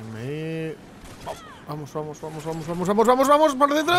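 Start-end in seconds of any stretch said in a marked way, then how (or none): 1.7-3.9 building excitement. Real, not acted. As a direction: urge it on faster and higher till it bursts. Urging on a play in game.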